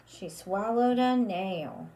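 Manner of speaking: ordinary delivery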